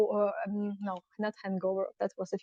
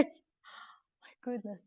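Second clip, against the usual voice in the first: awed hush; 14 dB quieter, much slower than usual. Real, not acted. Awed while seated on hay.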